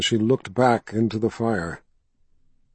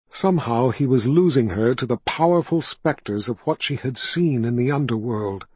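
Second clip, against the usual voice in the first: ordinary delivery, nothing marked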